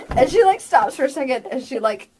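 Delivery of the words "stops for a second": ordinary talk, nothing marked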